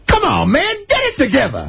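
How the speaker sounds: a lightly mocked stern order, high and laughing; dead serious vet. Comic imitating the vet scolding him on the base, dead serious but laughing. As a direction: play the serious vet while cracking up. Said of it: "dead serious".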